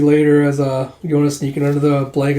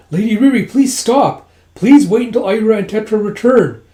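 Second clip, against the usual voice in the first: high, loud acted plea; imploring, raised voice; urgent pleading; 6 semitones higher, 6 dB louder than usual. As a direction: beg her to stop. Acted plea to stop Riri.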